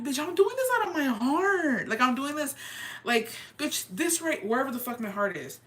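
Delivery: high-pitched, hurt indignation; dramatized wounded retort, high but not shouted; acted indignant protest. Acts out protest at her critics.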